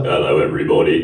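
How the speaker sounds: very low, booming robot voice; deep robot voice. Retold or imitated. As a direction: drop way down like a robot. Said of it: "sounded like a robot".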